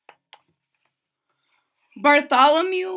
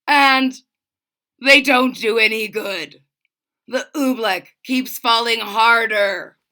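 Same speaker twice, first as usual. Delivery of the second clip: lightly voiced anxious king, louder; fretful king; 7 dB louder than usual. A narrator's reading. King fretting to himself over failed spells. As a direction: worry aloud like a stuck king.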